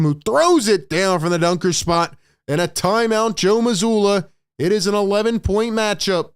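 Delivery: lively commentator call; excited play-by-play. Acted. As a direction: ride the run up to the timeout. Calls live action.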